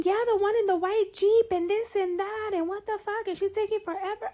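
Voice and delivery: very high, loud mocking scream; screaming homeless guy. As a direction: screech it like the stranger yelling at her car. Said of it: "This guy was yelling and screaming".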